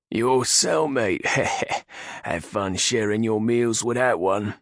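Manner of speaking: slow, loud sneering orc; mocking orc guard. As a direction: taunt him with a laugh. Orc guard mocks Nath.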